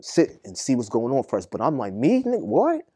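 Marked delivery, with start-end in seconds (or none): 1.9-2.8 much higher, louder indignant retort; replaying a jail phone-line standoff, high and loud; retold heated challenge. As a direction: bristle back like you've been disrespected. Retold confrontation over the phone.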